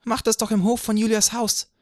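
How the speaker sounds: put-on girl proposes Juliet's courtyard, high and loud; girl suggesting. A narrator's reading. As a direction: pipe up as a bright young girl. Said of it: "schlug ein Mädchen vor".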